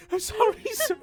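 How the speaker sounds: high, timid, apologetic guard; timid rookie. Acted. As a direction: squeak out a nervous apology.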